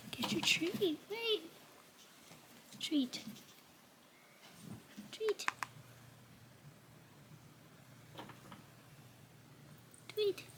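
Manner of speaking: soft, high calling; high coaxing call. Real, not acted. Calling the cat back for her treat, soft and high.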